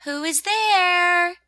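high, loud, drawn-out question; rosebud calling out. Acted. Rosebud to whoever is tapping, calling out. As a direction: call it out slowly, stretching the last word. Rosebud demands who is knocking.

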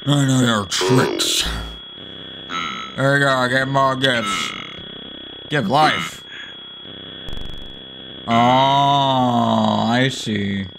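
normal talking voice

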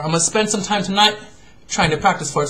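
usual speaking voice

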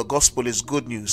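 normal talking voice

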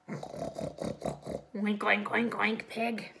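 oinking like a pig, hand under chin; pig oinks. Shown as a demonstration. Teacher leads children in pig noises, oinking. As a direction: grunt out the oinks. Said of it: "Put your hand underneath your chin and go".

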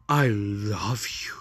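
slow, hammed-up whispered endearment; syrupy mock whisper; mock loving whisper. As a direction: whisper it with fake adoration. Mockingly reads lovey text.

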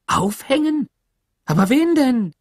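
high, loud shocked questions; horrified disbelief. A narrator's reading. Teacher hearing the sheriff mean to hang someone, aghast. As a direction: cry out the questions in alarm.

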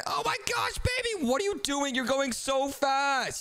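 high and frantic; high panicked yelp. Real, not acted. Gamer panicking as the baby outpaces him, high squeal. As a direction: squeal in alarm.

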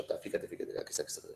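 susurro. Real, not acted. Señala en el dibujo.

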